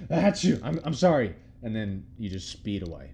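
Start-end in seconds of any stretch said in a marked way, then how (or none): 0.1-0.6 loud high fake achoo; sneezing. Shown as a demonstration. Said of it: "maybe like a". 0.6-1.3 high hurried sorry after sneeze; sneezy apology. Shown as a demonstration. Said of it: "maybe like a".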